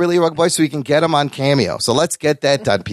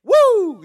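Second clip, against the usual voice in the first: Ric Flair woo. Retold or imitated. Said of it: "Give him a little Ric Flair".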